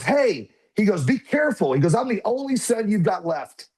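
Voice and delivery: put-on high, cutting voice of a mad five-year-old; angry little boy. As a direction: play the hurt, defiant kid.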